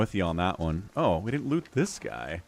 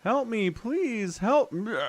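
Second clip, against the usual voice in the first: much higher, slow, begging cry; pleading captive; 13 semitones higher, slower than usual. Acted. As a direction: beg for rescue, voice pitched way up. Captive cook begs for help.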